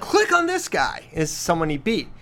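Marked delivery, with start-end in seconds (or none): 0.0-1.0 put-on high and slow, a kid insisting; eager kid voice. Son on the rating site, high eager kid voice. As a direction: pitch it up like an excited boy. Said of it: "And then he's like".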